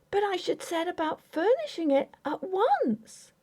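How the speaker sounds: put-on high, pleading young wife voice; young wife Grace. A narrator's reading. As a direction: voice Grace, hurt and insistent.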